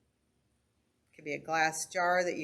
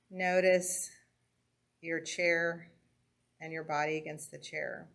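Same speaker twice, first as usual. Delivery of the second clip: calm grounding exercise. Acted. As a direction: guide the breathing gently with pauses. Leads a breathing and senses exercise.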